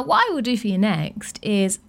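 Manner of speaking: ordinary delivery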